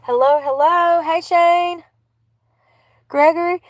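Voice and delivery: high bright hello; cheerful greeting. Real, not acted. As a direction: call out happily to friends. Greeting friends at station.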